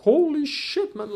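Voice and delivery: shocked holy shit. Retold or imitated. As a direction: replay the moment the oath warning hit. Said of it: "I'm like".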